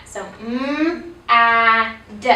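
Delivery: "Mm" hummed long, "ah" held, "d" clipped; slow segmented sounds. Shown as a demonstration. Teacher models the sounds of mad, slowly segmented. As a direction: stretch each sound of mad apart slowly. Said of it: "say it real slowly".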